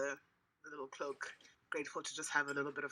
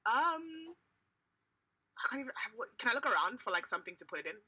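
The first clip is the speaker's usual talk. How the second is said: high, loud and eager; excited; 8 semitones higher, 4 dB louder than usual. Real, not acted. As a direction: rush it out excitedly. Excited player question.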